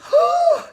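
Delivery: a big mock gasp, pitched high; hammed-up delighted gasp. Gloria gasping at the TV repairman, hammed-up. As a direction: swoon at him with a loud gasp.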